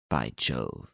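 low, soft muttered oath. A narrator's reading. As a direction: mutter it under the breath. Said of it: "muttered Renine".

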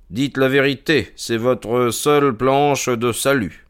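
lightly voiced, self-assured investigator; measured detective's statement; composed detective Holmes. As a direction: lay out the case with calm authority.

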